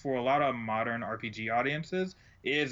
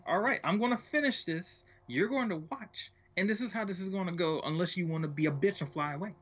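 higher, hammed-up indignant voice; mock furious Luke; 7 semitones higher than usual. Luke caught by Jesus, higher mock-furious defiance. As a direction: defy him, higher pitched and mock outraged. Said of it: "furiously continues, eye contact direct".

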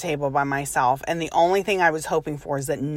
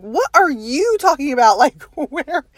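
louder and higher mocking echo; incredulous echo; 9 semitones higher, 6 dB louder than usual. Wife echoing her husband's words back, loud and incredulous. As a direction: throw his words back at him in disbelief. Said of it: "I'm like".

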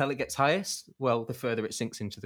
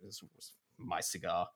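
much softer, lower grumbling; muttering; 6 semitones lower, 11 dB quieter than usual. Retold or imitated. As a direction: mutter it under your breath. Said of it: "muttering to himself".

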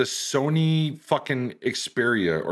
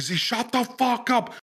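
high, strained, drawn-out yell; slow mock shout, pitched way up; retold yelled rebuke; 14 semitones higher, slower than usual. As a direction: imitate a commander losing patience. Commander's rebuke as quarrel escalates.

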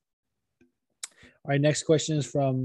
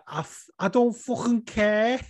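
slow, higher Scouse accent; Scouse trigger phrase; 8 semitones higher than usual. Shown as a demonstration. Host demoing his Scouse trigger phrase from dialect class. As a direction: lean into a Liverpool drawl on fucking care. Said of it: "with Scouse".

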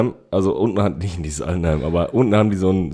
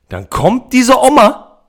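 higher, louder and slow, reliving the shock; excited disbelief; 7 dB louder, much slower than usual. Real, not acted. Telling a friend how a granny groped him, in higher, louder, slow disbelief. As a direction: drag it out in disbelief, loud and high.

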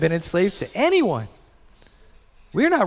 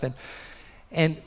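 ordinary talk, nothing marked

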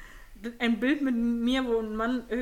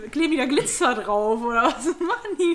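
baffled; 4 semitones higher, 9 dB louder than usual. Retold or imitated. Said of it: "Ich dachte so, wie?".

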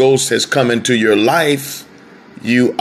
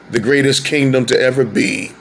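normal talking voice

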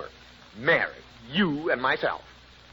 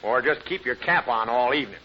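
ordinary talk

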